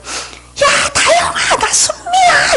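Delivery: high-pitched joyful shout, acting out a friend; excited reunion cry. Long-lost friend calls after years apart, excited cry.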